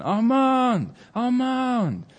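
high, drawn-out call; father's long searching cry; shouting for his son. Retold or imitated. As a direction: call out for a lost son, pitch soaring then falling. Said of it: "shouting to his son".

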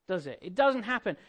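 normal talking voice

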